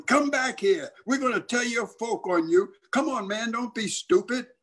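high-pitched pleading holler; imitating kids yelling after their friend. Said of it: "the kids were hollering".

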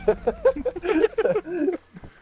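high burst of laughter; loud laugh. Real, not acted. Laughing at grenade play.

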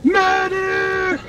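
high, far-off call for a medic; man down shout. Pretend. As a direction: cry out like a downed soldier. Calling for help after wreck.

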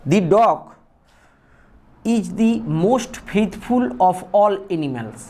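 each word spaced out for copying; slow dictation. Shown as a demonstration. Teacher dictating an example sentence to students, slow and deliberate. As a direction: read it out slowly for students to write.

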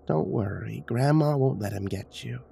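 hushed, eerie grandmother voice; old woman's whisper. A narrator's reading. Grandma reassures a child in the blackness, whispering. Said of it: "old woman's voice whispering in the blackness".

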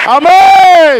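loud, high amen; shouted amen. Real, not acted. Preacher calling the congregation to answer, shouting. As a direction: shout it to the whole church.

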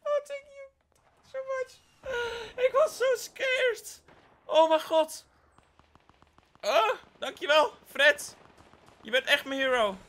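high, loud grateful thanks; relieved gushing. Real, not acted. Thanking teammate Fred for the revive, relieved and gushing. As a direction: gush with relief.

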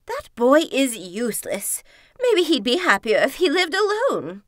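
loud, exasperated mother's complaint. A narrator's reading. Mother complaining about her useless son, loud and exasperated. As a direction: voice a fed-up mother, rising in pitch and volume.

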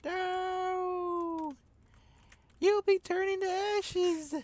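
high, loud hammed-up cry; mock dramatic shout. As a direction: wail it like a melodrama. Reacting to friend in fire.

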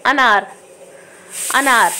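अनार with a short अ, said again and again; short a demo. Shown as a demonstration. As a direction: repeat अनार lightly on the अ. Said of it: "कम आ रही है". (अनार दोहराते हुए छोटा अ)

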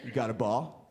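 low, simple dog voice. Retold or imitated. Comic voicing his dog asking to play, low dog voice. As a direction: talk like an eager, dim dog.

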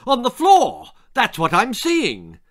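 high, loud screech; shrieked outburst, pitched right up; mother's screech. A narrator's reading. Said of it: "screeched her mother".

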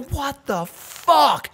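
high, slow, drawn-out exclamation; slow shocked outcry. Retold or imitated. Reliving the eye poke, slow high disbelief. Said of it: "I go".

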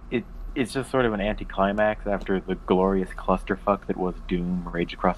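usual speaking voice

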